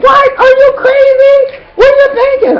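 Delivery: very high, loud mock shriek of disbelief; hammed-up high-pitched outrage; shocked friend. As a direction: imitate an aghast friend in a squealing falsetto. Retold friend shocked at marriage plan.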